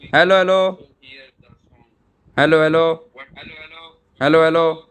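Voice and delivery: loud, high repeated "hello, hello"; calling hello. Shown as a demonstration. Testing the store camera speaker by calling loudly. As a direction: call out through the camera speaker. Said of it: "we can also hear the sound".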